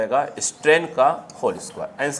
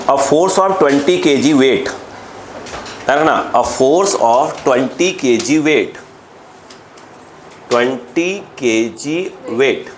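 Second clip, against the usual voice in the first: slow, deliberate dictation to a class; slower than usual. Real, not acted. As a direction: dictate word by word with gaps for writing. Teacher dictates the next question. (धीमे-धीमे बोलकर लिखवाना)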